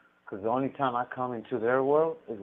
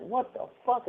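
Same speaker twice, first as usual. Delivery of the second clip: high, startled "What the fuck?"; sudden surprised outburst, voice jumping up; retold shock; 13 semitones higher than usual. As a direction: pitch leaps in disbelief. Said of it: "I'm like".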